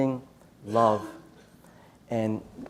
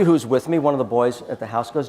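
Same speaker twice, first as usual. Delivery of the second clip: usual speaking voice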